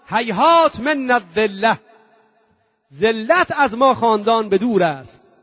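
proclaiming cry. Retold or imitated. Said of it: "فریاد زد".

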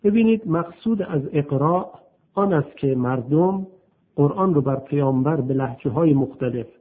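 usual speaking voice

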